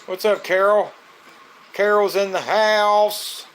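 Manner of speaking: high, loud, slow hype-man call; stretched, loud welcome for a viewer entering the live; hype announcer greeting. Real, not acted. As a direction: announce her like she just walked into the club. Hyping arrival of viewer.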